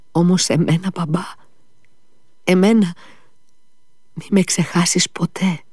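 put-on high, pleading child's voice; dying boy begging his father, high and pleading. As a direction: beg not to be forgotten. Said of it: "εξακολουθούσε ο Ηλιούσα".

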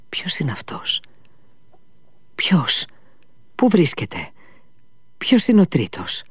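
rising scared questions; frightened. Acted. Ivan asking fearfully who the third is, frightened. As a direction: ask in mounting fear, looking around. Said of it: "πρόφερε φοβισμένα".

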